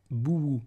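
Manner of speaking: "bouou" as bou-wou with a falling end; not a long vowel. Shown as a demonstration. Teacher back to consonant waw, "bouou" in two parts. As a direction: put a w glide inside "bouou". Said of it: "c'est pas une voyelle longue".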